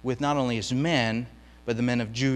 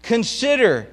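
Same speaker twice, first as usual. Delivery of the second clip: pleading; 9 semitones higher, 7 dB louder than usual. Acted. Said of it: "he's still pleading with God".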